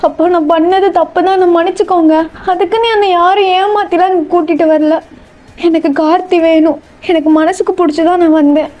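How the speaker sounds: distressed daughter. Acted. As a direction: defend your love to your father, pitched high. Daughter stands up to father. (கெஞ்சும் மகளின் உயர்ந்த குரல்)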